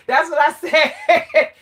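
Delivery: slow, amused words broken by laughs; laughing. Real, not acted. Woman tells how she answered her ex, laughing.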